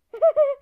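high, loud burst of laughter. Real, not acted. Child cracks up during toy play, loud laugh.

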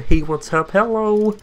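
lightly done Kermit greeting; mild Kermit impression; Kermit the Frog. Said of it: "Kermit the Frog?".

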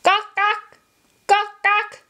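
high squawking bird call; sharp high bird squawks; hornbill call. Acted. Hornbill call in picture book.

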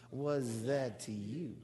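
slow, stressed WAS, rising at the end; led call-and-response. Shown as a demonstration. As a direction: say "Was that to you?" slowly, leaning on WAS. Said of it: "Come on, do it".

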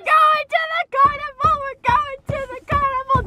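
high, thrilled squeal; excited shout. Real, not acted. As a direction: shout it with pure joy. Excited news of carnival trip.

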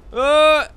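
sudden high loud yelp; sharp alarmed exclamation; startled shout. Real, not acted. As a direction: cry out in surprise. Startled cry.

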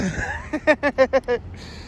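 breathy laugh of relief; relieved laugh. Real, not acted. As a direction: laugh it off after the scare. Laughs at finding the key.

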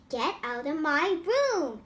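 ordinary delivery, nothing marked

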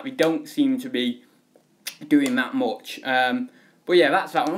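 ordinary delivery

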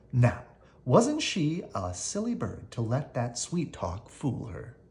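ordinary delivery

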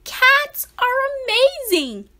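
high proud teacher; teacher's boast. A narrator's reading. Teacher telling the class, high and proud. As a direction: announce it proudly. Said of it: "she said".

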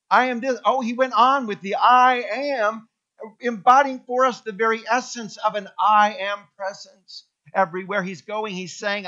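loud, high preaching; full-force preaching. Acted. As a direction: proclaim it to the whole church.

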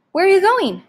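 sharp, high and loud question; alarmed question. A narrator's reading. Mother calls after father leaving, high and loud. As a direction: call after him in alarm. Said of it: "Mother asked".

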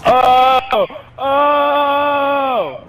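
loud, high gleeful yelps; excited shout. Real, not acted. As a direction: howl with delight. Teammate kicked, laughing outburst.

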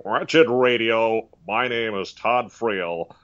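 slow cartoon-character radio host; Todd Friel. Host introducing Wretched Radio, slow cartoonish voice. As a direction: stretch each word out like a cartoonish announcer. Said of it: "he kinda sounds like a cartoon character".

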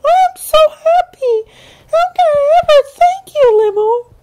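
very high, loud and quick; bursting with thanks; squeaky joyful voice; happy little Pai Hai. A narrator's reading. Nani speaks.

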